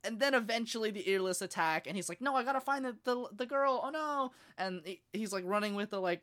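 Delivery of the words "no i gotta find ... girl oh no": high, fretful mock alarm; mock panic. Boy looking for the girl as Earless attack, high mock panic.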